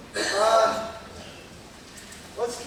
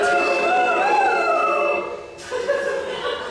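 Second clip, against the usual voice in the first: shrill laugh. Acted. Young woman laughs in court.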